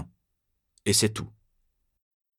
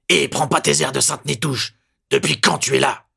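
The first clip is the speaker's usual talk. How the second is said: loud, high hostile voice; angry accusation; 7 semitones higher, 5 dB louder than usual. A narrator's reading. Lieutenant accusing Lupin, loud and hostile. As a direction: sneer and accuse.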